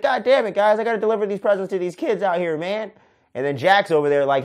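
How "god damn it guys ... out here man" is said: higher, put-upon Santa griping at his kidnappers; Santa complaining. Retold or imitated. Kidnapped Santa gripes at his captors in a higher, put-upon voice. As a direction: whine like a Santa shoved in a sack.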